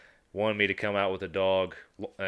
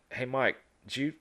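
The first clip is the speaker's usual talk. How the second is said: surprised question; 6 semitones higher than usual. Retold or imitated. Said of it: "I was like".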